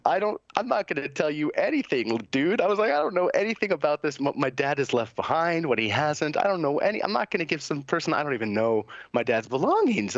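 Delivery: high, heated retelling of a flat refusal; indignant refusal. Retold or imitated. Telling the caregiver he won't hand over dad's things, indignant and high. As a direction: push back hard, voice up high and incredulous.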